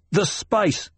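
high, urgent interruption; agitated. A narrator's reading. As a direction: break in with the answer. Agitated son interrupts mother.

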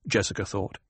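ordinary delivery, nothing marked

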